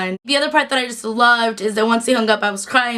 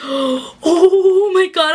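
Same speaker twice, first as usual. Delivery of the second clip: drawn-out, high, loud delight; thrilled; 6 semitones higher, 4 dB louder, much slower than usual. Real, not acted. Vlogger to viewers about the crossover, slow, high, loud thrill. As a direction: stretch it out in pure fan excitement.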